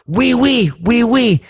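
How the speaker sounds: high, eager French yeses; French tourist. Acted. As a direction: chirp agreement in a high voice. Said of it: "And the French guy goes".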